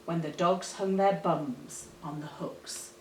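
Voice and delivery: plain reading voice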